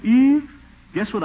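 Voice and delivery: high, loud call of her name; bursting to tell her his news; eager call. Acted. To Eve before sharing his secret, a high loud eager call.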